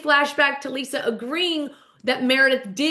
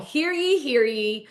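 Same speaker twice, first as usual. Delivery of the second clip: town crier; 2 semitones higher, slower than usual. Retold or imitated. Bronwyn calls court into session, proclaiming like a crier. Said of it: "calls court into session with the old".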